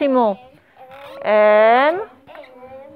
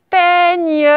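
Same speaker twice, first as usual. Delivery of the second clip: PEIGNE louder, drawn out in two parts; dictation; 4 semitones higher, 4 dB louder than usual. Shown as a demonstration. Teacher dictates a word to the class, dictation. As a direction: stretch PEIGNE out for spelling.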